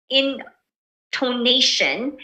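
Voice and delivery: INTONATION stretched out slowly, rising at the end; drawn-out intonation. Shown as a demonstration. Teacher defining intonation for presenters, drawn out and rising. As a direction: say "intonation" slowly, letting the pitch climb. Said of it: "is the rise and fall of pitch".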